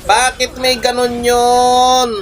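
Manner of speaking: high, drawn-out disbelieving wail; exasperated outcry. Real, not acted. Streamer wailing at a surprise attack, high and slow.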